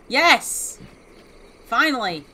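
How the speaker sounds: loud, high yes of success; triumphant. Real, not acted. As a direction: exclaim it in relief and triumph. Success after trying gun cleaning.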